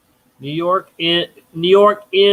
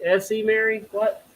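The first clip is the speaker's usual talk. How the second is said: ordinary talk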